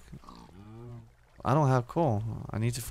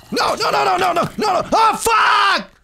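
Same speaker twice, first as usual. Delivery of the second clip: very high, loud shouted noes; desperate shouting; 8 dB louder than usual. Real, not acted. Gamer yelling as the mobs finally get him, desperate shouts. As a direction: yell as everything goes wrong. Dying again in the game.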